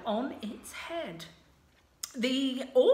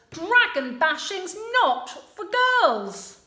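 louder, dismissive prince; lightly voiced bossy prince; smug prince; 5 semitones higher, 5 dB louder than usual. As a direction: brush her off. Prince lecturing Sue.

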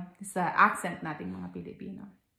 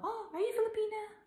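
much higher, light imitation of a surprised questioner; curious stranger; 3 dB quieter than usual. Foreigner guessing a Filipina's origin from her accent, high curious imitation. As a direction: mimic someone else asking in a high little voice. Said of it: "um, sabi nila".

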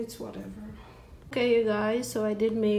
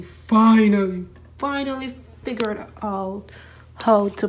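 triumphant shout; 9 dB louder than usual. Real, not acted. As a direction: belt out finally in relief.

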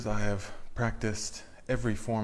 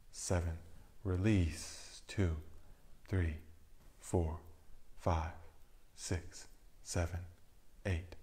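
soft, sinking counts with the cue words lifted; guided breath counting; 2 semitones lower, 4 dB quieter, slower than usual. Acted. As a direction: count the breaths calmly, letting each number fall away. Leads a breathing meditation.

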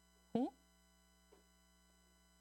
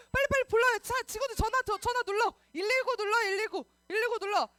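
high, loud, hurried urging; rally children to make an emergency call; pretend urgency; 7 semitones higher, 5 dB louder than usual. Urges kids to call firefighters. (다급하게 재촉하기)

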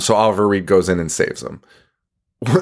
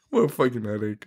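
usual speaking voice

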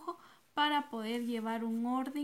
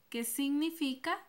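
ordinary delivery

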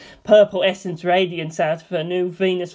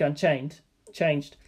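ordinary delivery, nothing marked

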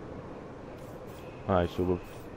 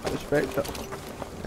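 slow whisper of I respect it; 3 semitones higher, 9 dB quieter, much slower than usual. Real, not acted. Gamer quietly admiring his droid, a slow whisper. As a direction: breathe it out, slow and hushed. Remark said twice.

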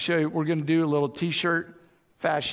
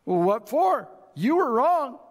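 higher-pitched acted comeback, rising into the accusation; indignant retort; 8 semitones higher than usual. Spouse refusing to apologize in an argument, indignant. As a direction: play an argument, jumping up in pitch.

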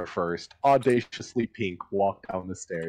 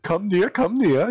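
high, louder put-on character voice; high-pitched, pleased summons; Kat beckoning; 12 semitones higher, 5 dB louder than usual. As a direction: beckon them over in a high voice. Game master voices Kat beckoning.

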